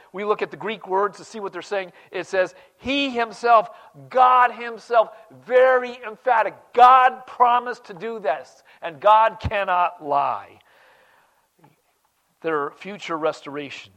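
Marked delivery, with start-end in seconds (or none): 2.8-10.6 high, loud, slow insistence; emphatic. Acted. Preacher insisting to the flock that God keeps promises, loud and high. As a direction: hammer home that God cannot lie.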